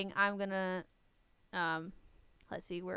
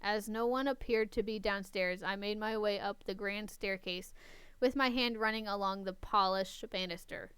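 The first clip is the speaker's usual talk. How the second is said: story narration; 3 semitones lower, 11 dB quieter than usual. Acted. Reads the story aloud.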